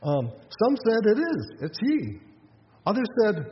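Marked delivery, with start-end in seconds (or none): 1.2-2.2 high-pitched, certain recognition; raised-pitch acted insistence; insistent neighbor. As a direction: play a bystander who is sure. Acted neighbors arguing over his identity.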